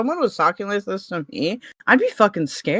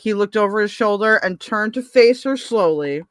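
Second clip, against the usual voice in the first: ordinary talk, nothing marked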